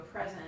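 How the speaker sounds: "present" stressed on the first syllable, PREZ-ent; noun "present". Shown as a demonstration. Tutor giving a gift example to a student, "present" stressed on PREZ. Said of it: "And so that's a noun".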